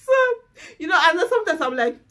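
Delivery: fast, high, through laughter; laughing. Real, not acted. Wife still laughing on camera, laughing. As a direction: keep going while laughing.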